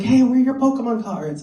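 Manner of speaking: put-on a kid demanding his treat; eager kid. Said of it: "He's like".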